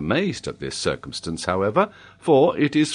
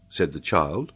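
ordinary delivery, nothing marked

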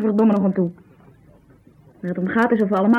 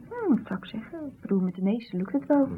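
whisper; 8 dB quieter than usual. Acted. Nurse answers awkward question.

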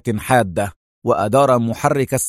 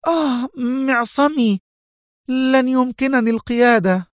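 very high-pitched cry of pain; pained, vexed moan; 12 semitones higher than usual. A narrator's reading. Sonia hitting her wrist on the wheel, moaning furiously. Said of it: "فتأوهت في قوة وهتفت في حنق".

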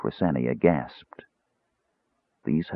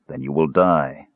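grimly; 2 semitones lower than usual. A narrator's reading. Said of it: "Raistlin said grimly".

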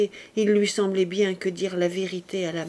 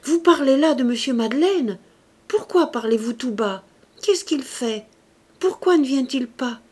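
loud, higher, brusque demand; brusque outburst, raised in volume and pitch; sudden cry; 4 semitones higher, 7 dB louder than usual. A narrator's reading. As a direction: cry out impatient questions. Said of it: "Tout à coup, elle cria; Sa voix était si brusque et si rauque".

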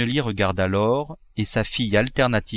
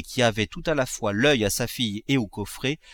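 plain reading voice

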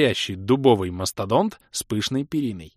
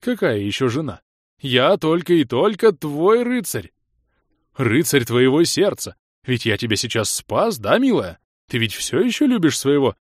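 put-on high, loud cooing baby talk; 3 semitones higher, 5 dB louder than usual. A narrator's reading. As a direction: coo at her like to a toddler. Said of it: "засюсюкал Алый Крестоносец".

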